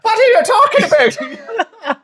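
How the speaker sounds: put-on over-the-top Canadian accent, cracking up; hammed-up Canadian accent. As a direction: go all in on a silly Canadian accent. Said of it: "That's my best Canadian accent yet".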